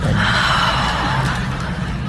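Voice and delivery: sharp gasp of mock outrage; shocked gasp. Real, not acted. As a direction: gasp like you're scandalised.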